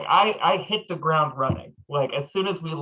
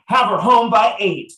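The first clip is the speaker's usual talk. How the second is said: loud, higher stern dad warning; gruff overprotective dad; 7 semitones higher, 8 dB louder than usual. Acted. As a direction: warn him like a strict father. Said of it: "just fully committed to this ruse".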